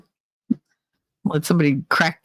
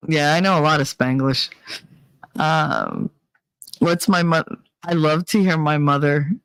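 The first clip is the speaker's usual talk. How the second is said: ordinary talk, nothing marked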